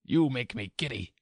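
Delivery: high-pitched cross queen; irritated queen. A narrator's reading. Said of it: "screamed the queen".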